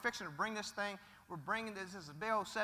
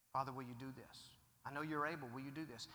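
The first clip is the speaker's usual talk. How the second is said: soft, lower pleading prayer; hushed plea; 6 semitones lower, 8 dB quieter, faster than usual. Acted. Son quietly begging his father in prayer.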